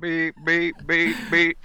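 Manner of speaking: high beep-beep like a reversing truck. Retold or imitated. Host imitates the Falcon backing up, high beeping. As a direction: mimic a vehicle backing up.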